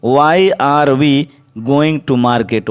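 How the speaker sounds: very slow, word by word, clearly spaced; slow dictation. Shown as a demonstration. Teacher dictating to the class, very slow and clear. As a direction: spell it out slowly for students to write. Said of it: "teacher dictates the English sentence".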